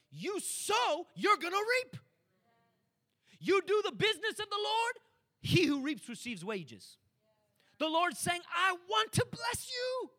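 high, loud climbing exhortation; full-force preaching. Real, not acted. Preacher exhorting his congregation about giving, loud and climbing. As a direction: build to a shouted promise.

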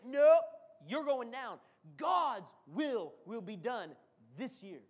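big loud nope then slow; loud declaration. Acted. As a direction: shut fear down. Declaring against fear.

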